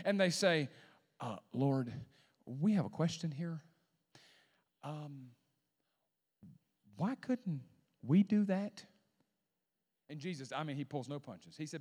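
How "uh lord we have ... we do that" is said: hesitant, hushed question, imitating sheepish followers; soft and halting, with awkward pauses; embarrassed disciples. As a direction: ask it gingerly, as if mildly embarrassed. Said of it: "'cause they're probably embarrassed".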